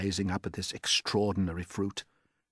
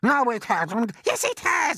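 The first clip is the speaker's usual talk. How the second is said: a far higher, louder aunt's shout; Aunt Spiker shouting; 7 dB louder than usual. Acted. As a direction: screech it out as the nasty aunt.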